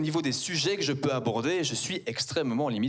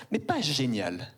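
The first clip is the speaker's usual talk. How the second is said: ordinary talk, nothing marked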